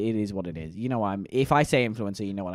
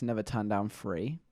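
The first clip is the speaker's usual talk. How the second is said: ordinary talk, nothing marked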